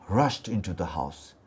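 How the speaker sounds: usual speaking voice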